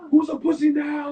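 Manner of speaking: high, loud mock attacker shout; retold taunting shout. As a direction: yell it as the triumphant attacker, hammed up. Attacker's taunt in retold window smashing.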